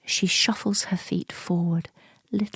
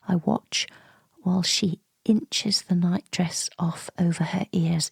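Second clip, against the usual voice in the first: usual speaking voice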